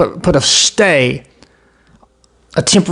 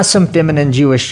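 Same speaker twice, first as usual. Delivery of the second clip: ordinary talk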